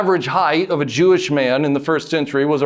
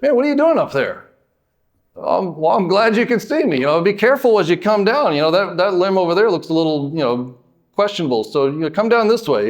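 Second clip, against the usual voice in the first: casual, higher-pitched, chatty concern; friendly passerby; 4 semitones higher than usual. Acted. Friendly person greeting Zacchaeus up in the tree, light and casual. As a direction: play a friendly guy, hesitant and rambling. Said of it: "A friendly person walking by seeing Zacchaeus".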